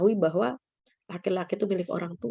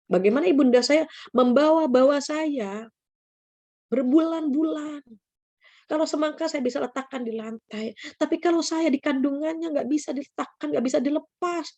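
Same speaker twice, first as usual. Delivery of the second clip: higher-pitched heartfelt retelling; raised pitch, earnest and insistent; impassioned realization; 6 semitones higher than usual. Retold or imitated. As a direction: plead the point as the awakened student. Student's realization about mother, acted.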